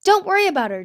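high, loud and cheery; upbeat, breezy reassurance; happy. A narrator's reading. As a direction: reassure her brightly and loudly. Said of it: "nudged her happily".

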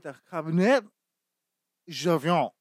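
"Cabernet Sauvignon" choked out with guttural French sounds; mock French pronunciation. Customer repeating his wine order, "Cabernet Sauvignon" in choking French. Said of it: "Bro, are you choking?".

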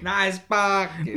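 slow, high-ish sarcastic echo; a light imitation of the rude driver; mock grumpy driver. Friend echoes the rude driver, slow mock sneer.

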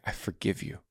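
whisper. Pretend. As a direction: breathe the words to Dad. Voicing forgiveness to a father.

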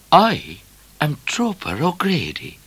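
ordinary delivery, nothing marked

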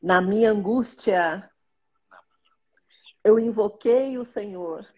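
slow, devout reading of a psalm; prayerful psalm reading. Acted. Mother reading her daily psalm to viewers, slow and devout. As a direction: read each verse slowly like a prayer.

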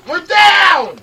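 high, loud alarmed shout. Real, not acted. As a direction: yell it in sudden alarm. Audio problem mid-recording.

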